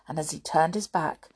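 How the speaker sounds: ordinary delivery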